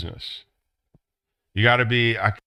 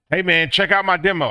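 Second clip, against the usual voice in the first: high, loud, eager hustler; excited pitch; 10 semitones higher, 6 dB louder than usual. Retold or imitated. Artist pitching the studio owner his demo, excited. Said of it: "everybody's excited".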